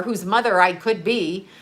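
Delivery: usual speaking voice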